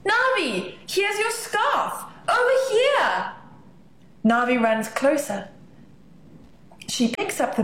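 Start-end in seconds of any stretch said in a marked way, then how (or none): 0.0-3.3 calling out. Acted. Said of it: "Sabek calls out".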